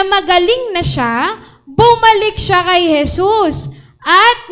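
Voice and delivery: loud, high preaching at full force; fervent scripture reading. Acted. Preacher reading Luke to the church, loud and fervent. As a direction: proclaim the verses to the congregation.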